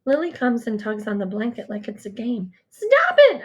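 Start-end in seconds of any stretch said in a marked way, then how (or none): 2.7-3.4 high, loud panicked scream. A narrator's reading. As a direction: shriek it out to stop them. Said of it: "I scream".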